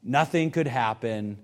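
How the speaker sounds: low, soft, settled assurance; quiet reassurance. Acted. Preacher reassuring anxious worshippers before worship, low and soft. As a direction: bring it right down to soothe an anxious heart.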